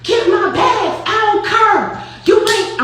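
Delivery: high, slow, loud St. Louis voice of an angry friend; Saint Louis accent. Retold or imitated. Imitating Noonie's Saint Louis accent yelling at Flo. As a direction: lean into the Saint Louis drawl, furious. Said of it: "I'm doing her accent".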